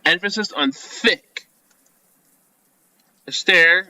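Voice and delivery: normal talking voice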